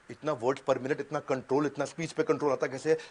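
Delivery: ordinary talk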